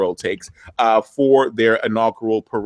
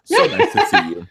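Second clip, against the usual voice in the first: sudden high-pitched, laughing welcome; mock delighted greeting. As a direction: flip up high in sham delight. Mock greeting in acted scene.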